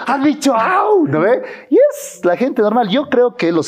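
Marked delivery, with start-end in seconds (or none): 0.1-1.1 high, slow, giggling mimicry of the yelp; laughing mock quote. Guest laughs, imitating someone crying ouch when poked. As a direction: laugh it out while mocking the ouch.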